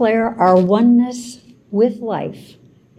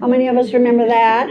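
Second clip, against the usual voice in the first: ordinary talk, nothing marked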